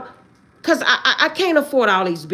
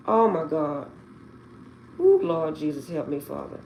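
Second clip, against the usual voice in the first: low, fading plea to God; hushed prayer; 5 semitones lower, 7 dB quieter than usual. Real, not acted. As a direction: pray softly, letting the voice sink away. Muttered plea to God, distressed.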